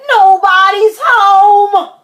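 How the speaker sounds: high, loud, drawn-out wail; upset kid's slow wail; crying out. A narrator's reading. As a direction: complain at full voice, stretching each word. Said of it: "cried Froggy".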